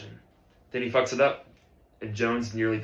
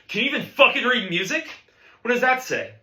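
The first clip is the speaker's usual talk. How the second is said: high, pitched-up yelling; irate bandleader grilling a student; angry berating; 11 semitones higher, 5 dB louder than usual. Acted. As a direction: demand it in exasperation. Teacher berating drummer.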